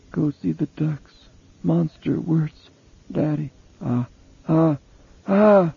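put-on a delirious little boy, words coming in high fragments; guttural delirium. A narrator's reading. Boy in convulsions babbling to his mother, high and strangled. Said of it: "he said gutturally".

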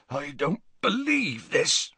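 slow, hoarse disbelief. A narrator's reading. Hagrid stares at Ron in slow hoarse disbelief. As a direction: rasp it out slowly, stunned. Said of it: "said Hagrid hoarsely".

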